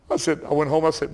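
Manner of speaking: usual speaking voice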